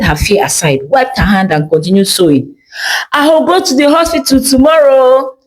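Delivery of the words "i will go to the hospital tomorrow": ordinary delivery, nothing marked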